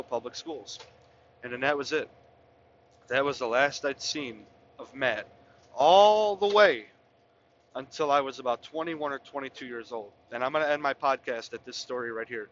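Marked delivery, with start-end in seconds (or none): 5.7-6.9 all the way stretched long, high and loud; "all the way" sung out long and high; drawn out. Real, not acted. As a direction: hold "all the way" out slowly for effect. Drawn-out emphasis in the story.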